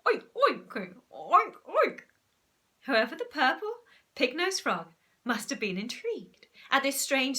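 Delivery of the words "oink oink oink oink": high, loud, plummy pig oinks; posh oinking. Acted. Zoo owner oinks poshly at the pig-nosed frog. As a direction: oink like a reluctant aristocrat. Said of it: "his oink sounded rather posh".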